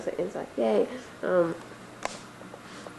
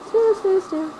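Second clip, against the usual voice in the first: normal talking voice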